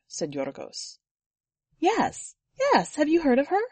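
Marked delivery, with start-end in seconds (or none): 1.8-3.6 excited. A narrator's reading. Eager that he knows the legend.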